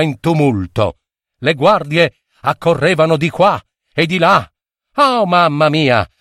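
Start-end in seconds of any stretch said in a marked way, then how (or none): none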